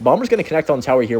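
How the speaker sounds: normal talking voice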